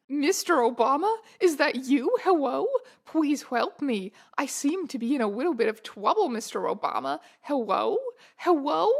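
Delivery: cutesy uwu voice turning l into w, hewwo; uwuified pleading. Acted. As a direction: plead in a cutesy baby-talk lisp. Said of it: "uwuified words".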